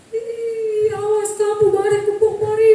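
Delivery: high, loud sing-song command; hammed-up teacher herding class; mock sing-song scolding. Guru menirukan teriakan kelas. (teriakan guru yang dibuat-buat)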